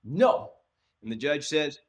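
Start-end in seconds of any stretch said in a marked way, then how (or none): 0.0-0.5 a loud, high, scornful no, as if the others are weak. Retold or imitated. Juror scoffing at the lawyer's question, loud and scornful. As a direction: dismiss the question outright. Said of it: "looked at the other people like they were weak".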